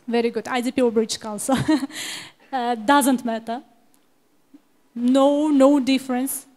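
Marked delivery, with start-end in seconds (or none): 1.6-2.2 brief chuckle at own remark; short laugh. Real, not acted. Teacher answering a student on exam choice, short laugh. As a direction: let out a quick little laugh.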